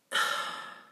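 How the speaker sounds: long breathy sigh; fed-up exhale; exasperated sigh. Real, not acted. Exasperated at how Phil treats Andy.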